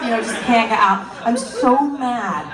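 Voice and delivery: usual speaking voice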